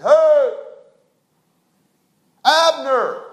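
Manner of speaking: loud call across the valley, held long. Retold or imitated. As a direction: shout down to Abner from the hill. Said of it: "he yelled down there".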